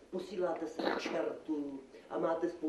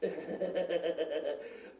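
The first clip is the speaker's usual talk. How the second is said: long bleating chuckle; acted nervous laugh. Magistrate laughs off grandmother's curse, bleating chuckle. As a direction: stutter out a stretched laugh.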